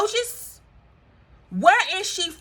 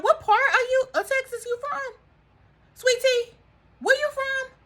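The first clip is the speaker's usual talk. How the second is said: higher, teasing call to Sweet Tea; 10 semitones higher than usual. Acted. Vlogger teasing Sweet Tea, asking where she's from, high call. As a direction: sing out the question like calling across the room.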